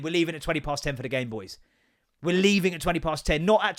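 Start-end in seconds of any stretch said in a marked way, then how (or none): none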